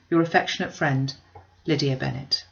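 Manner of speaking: plain reading voice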